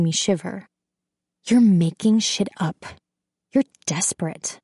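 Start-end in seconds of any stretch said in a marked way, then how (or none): 1.5-4.6 mildly venomous retort, dropping at the end; understated contempt; spitting accusation. A narrator's reading. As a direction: spit the accusation at him. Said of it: "I'm practically spitting on him".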